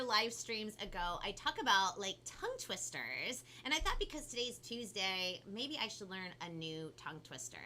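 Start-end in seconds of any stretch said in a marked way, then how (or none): none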